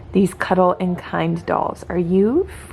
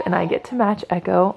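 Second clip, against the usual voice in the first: usual speaking voice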